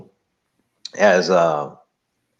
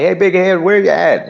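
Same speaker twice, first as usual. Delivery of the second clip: loud, higher, playful sibling call; teasing big brother; 11 semitones higher, 7 dB louder than usual. Retold or imitated. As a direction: rib her like a big brother on the phone. Teasing his mentee like a sibling.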